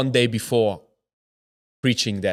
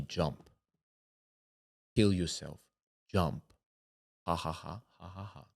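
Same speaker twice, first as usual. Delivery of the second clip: low, hushed, tempting command; sinister inner voice; 5 semitones lower, 12 dB quieter than usual. Retold or imitated. Voices urging him at the window, sinister hush. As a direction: urge softly in a low voice, then laugh faintly.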